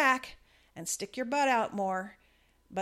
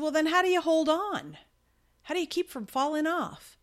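a hint of the friend, pitched higher and louder; imitating puzzled friend; 8 semitones higher, 6 dB louder than usual. Rider asking her instructor how to stay on, lightly imitated and higher. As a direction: lightly voice the baffled friend's question.

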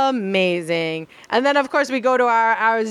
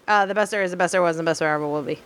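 fast catchphrase; 5 semitones lower, much faster than usual. Retold or imitated. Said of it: "catchphrase quoted".

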